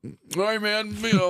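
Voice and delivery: high sheepish all right; squeaky mock reply. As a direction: pitch it way up like a meek kid. Mocking his own meek reply.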